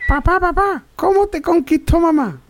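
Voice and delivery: put-on high, eager kid voice; child's voice. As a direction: ask like a small child. Child asking father in joke.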